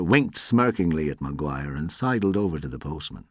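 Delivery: plain reading voice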